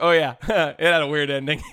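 imitating his dad chuckling through the words; mock dad laughing it off. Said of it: "and he starts laughing".

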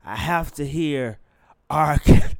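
loud, drawn-out craving outburst; hammed-up withdrawal cry; mock fiending. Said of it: "I was on a drug".

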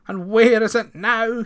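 plain reading voice

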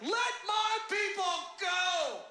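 high, loud, stretched-out bellow; shout with gusto. Acted. Aaron retries the demand to Pharaoh, with gusto. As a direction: belt out the demand with overblown confidence. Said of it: "Say it with more gusto this time".

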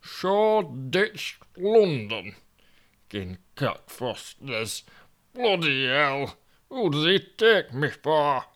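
a put-out northern man, lost in London; Toby grumbling in Yorkshire voice; grumpy Yorkshireman Toby. Acted.

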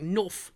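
"Nof" with an F sound for the TH. Shown as a demonstration. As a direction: say "Nof", ending in F like a Londoner. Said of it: "they have an F sound".

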